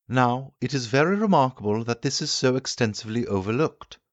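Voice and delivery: the keen inventor holding forth to his guests; the Time Traveller lecturing. Acted. Time traveller explains his theory.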